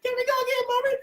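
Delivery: put-on high, soft childlike begging; mock child asking mommy to ride again; imitating little daughter. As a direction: plead sweetly like a small girl. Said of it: "And we was like".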